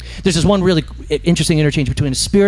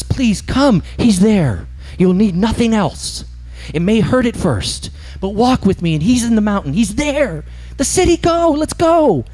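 high, urgent pleading, rising higher; pleading spirit; 8 semitones higher, slower than usual. Acted. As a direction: coax the ghost to come, voice climbing. Spirit begging ghost to come.

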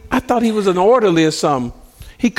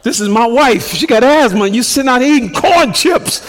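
louder, higher, rising indignation; indignant; 7 semitones higher, 5 dB louder than usual. Retold or imitated. Husband fuming inwardly at the orderly, louder and higher. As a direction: boil over at him. Seething at the corn-chip orderly.

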